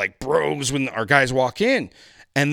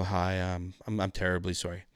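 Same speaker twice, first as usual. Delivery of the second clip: low, soft and halting; subdued mock contrition; sheepish apology; 7 semitones lower, 9 dB quieter than usual. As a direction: apologize meekly, as retold. Retells his reluctant apology.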